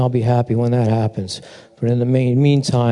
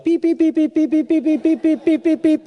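much higher beeping; mock complaining chirps. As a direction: chirp like a fussing bird before God. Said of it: "we go into the throne room and we're like".

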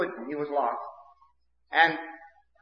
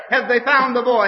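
higher and louder urgent question; anxious asking; 7 semitones higher, 6 dB louder than usual. Retold or imitated. Townsfolk asking each other about the lost boy, anxious and loud. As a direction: ask it like a worried neighbour.

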